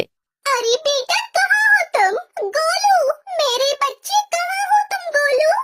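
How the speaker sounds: a blind mother calling out in panic, voice breaking; frantic searching mother. Acted. Blind mother searching the platform for her son, crying out.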